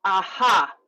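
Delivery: short ah, then a bursting ha; two-part vocal warm-up aha; breath exercise aha. Shown as a demonstration. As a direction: pop the ah and release the ha. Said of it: "So it's".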